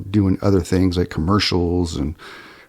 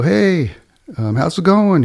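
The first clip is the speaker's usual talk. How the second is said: higher, breezy fake-friendly hello; mock casual greeting; 11 semitones higher than usual. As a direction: play it innocent, just a friendly fisherman. Narrator greets suspected thief casually.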